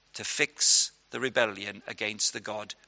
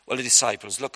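normal talking voice

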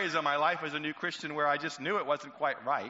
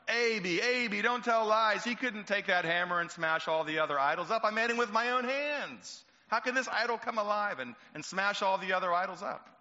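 scolding father; 7 semitones higher than usual. Acted. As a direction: chide him for telling lies. Father scolding his son.

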